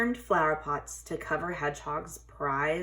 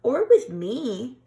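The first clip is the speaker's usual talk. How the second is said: high, slow, louder mouse voice; squeaky mouse; 6 semitones higher, 8 dB louder, slower than usual. A narrator's reading. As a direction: offer eagerly like a tiny mouse. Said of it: "suggested Mouse".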